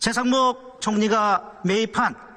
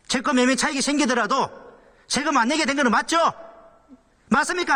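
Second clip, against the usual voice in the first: angry shouting; 7 semitones higher than usual. Real, not acted. Legislator pressing the minister hard.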